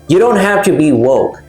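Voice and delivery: ordinary delivery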